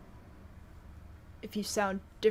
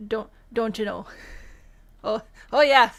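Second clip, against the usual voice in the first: hammed-up Midwest accent, bursting much louder on "oh yeah"; aggressive Midwest accent; 11 dB louder than usual. Streamer playing up home-state sayings for viewers, aggressive Midwest accent. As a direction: lay the Midwest accent on thick, with a laugh. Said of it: "the Midwest comes out a little bit more aggressively".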